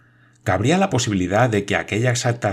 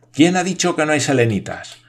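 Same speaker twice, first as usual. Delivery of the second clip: much higher, louder threatening yell; menacing shout; 7 semitones higher, 4 dB louder than usual. A narrator's reading. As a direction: challenge him menacingly. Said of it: "gritó Michel en tono amenazador". (grito amenazador y agudo)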